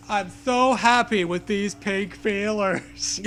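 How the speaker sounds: slow, laughing goofy delight; slow dopey joy with laughter; sand-hands voice. Pretend. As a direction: sound giddy and broken. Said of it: "this is what you sound like".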